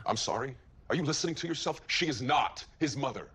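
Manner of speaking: incredulous, rising into a forceful denial; indignant disbelief. Acted. Father rejects the claim about his son's mother, indignant disbelief.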